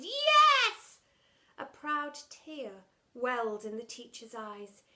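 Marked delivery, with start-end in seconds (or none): 0.0-1.0 high, loud cheer of yes; shouted yes. Acted. Boys cheering their teacher, shouting. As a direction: leap up and shout it. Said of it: "They shouted".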